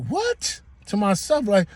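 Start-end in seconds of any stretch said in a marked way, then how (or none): 0.1-0.6 squeaky high disbelieving what; stunned high-pitched what; shocked what. Retold or imitated. Stunned inner reaction to insult.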